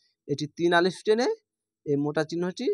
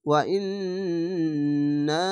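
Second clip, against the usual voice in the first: slow chanted Arabic with long vowels; tajweed recitation, slow and drawn; much slower than usual. Shown as a demonstration. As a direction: hold the vowels as in Quran reading. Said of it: "এরকমভাবে".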